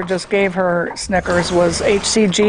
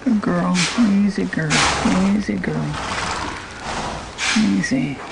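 soothing a horse; 4 dB quieter than usual. Real, not acted. Calming mare during insemination.

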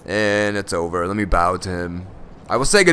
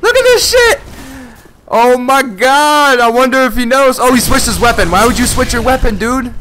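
much higher, much louder, thrilled yelling; excited shout; 13 semitones higher, 8 dB louder than usual. Real, not acted. Gamer spotting a Gundergale player, shouting with excitement. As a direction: shout it out in pure disbelief.

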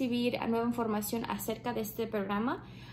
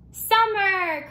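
excited reveal; 8 semitones higher, 9 dB louder than usual. Acted. Answering the guessing question.

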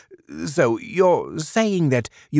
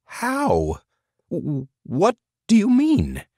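much higher, stammering; stunned; 14 semitones higher, slower than usual. A narrator's reading. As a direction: catch your breath, amazed. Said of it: "Burgum blinked".

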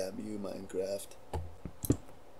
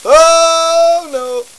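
high, loud shriek of alarm; panicked shout; 19 dB louder than usual. Real, not acted. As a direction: yell it in sudden panic. Sudden alarm in game.

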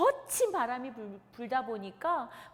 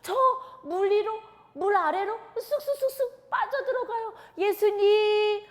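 much higher and louder, panicked sobbing call; desperate plea; 10 semitones higher, 10 dB louder than usual. Acted. Peter sinking in the sea, crying to Jesus for rescue. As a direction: beg to be saved from sinking. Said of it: "베드로가".

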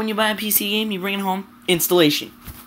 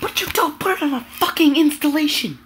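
ranting; 6 semitones higher than usual. Real, not acted. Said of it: "So that was my rant".

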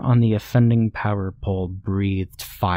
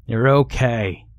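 mild narrated yell, only slightly raised; restrained yell; 3 semitones higher than usual. A narrator's reading. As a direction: suggest a yell without full volume. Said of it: "this time yelling".

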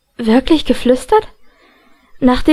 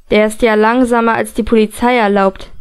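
ordinary delivery, nothing marked

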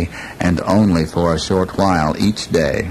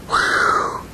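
sigh of relief. Acted. Relief after danger.

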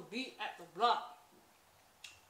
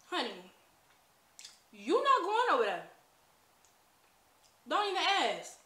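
higher, firm grandma voice; stern grandma; 3 semitones higher than usual. Retold or imitated. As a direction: lay down the law like grandma. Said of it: "she was like, like".

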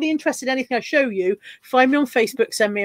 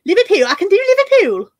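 high, loud mock Liverpudlian; Liverpool accent; 7 semitones higher, 6 dB louder than usual. Shown as a demonstration. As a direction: put on an exaggerated Scouse accent. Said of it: "Liverpudlian. That's weird".

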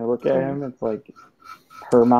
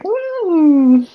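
imitated hum; 9 dB louder than usual. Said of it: "He was like".

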